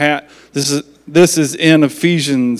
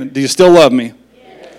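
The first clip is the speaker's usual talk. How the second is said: ordinary talk, nothing marked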